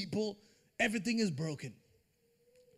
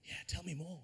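low hushed lean-in, hungry for more; hushed eager gossip; 5 semitones lower, 9 dB quieter than usual. Retold or imitated. Preacher mocking gossip-hungry listener, hushed and eager. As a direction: murmur it like a nosy friend leaning close. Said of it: "they lean in".

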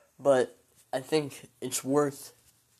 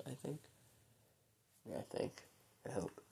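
fading to a near whisper; 14 dB quieter than usual. Real, not acted. Doubting friendships, trailing off.

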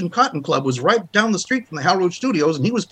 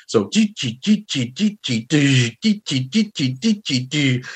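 imitated snare pattern, gee gee rhythm; mouth drum pattern; slower than usual. Shown as a demonstration. Showing the drum pattern Marvin taught, mouth drumming. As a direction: tap out the drum pattern vocally. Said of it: "a smear across the snare drum".

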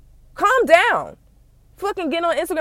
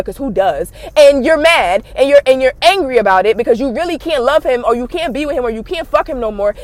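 ordinary talk

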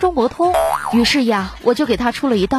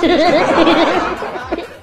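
laugh; 7 semitones higher, 3 dB louder than usual. Real, not acted. Teller laughs.

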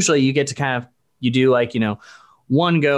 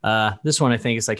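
normal talking voice

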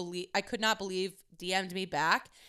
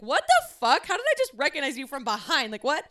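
raised, louder mock-shocked exclamation; incredulous quote of yourself, pitched up; retold astonishment; 4 semitones higher, 4 dB louder than usual. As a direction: replay a baffled double-take out loud. Retelling shocked reaction at recognizing him.

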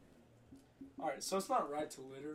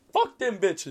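retold loud snap; 5 semitones higher, 14 dB louder, slower than usual. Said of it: "I straight up was like".

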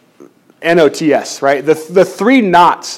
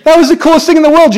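excited recollection; 9 semitones higher, faster than usual. Retold or imitated. Recalling the hospital with newborn.

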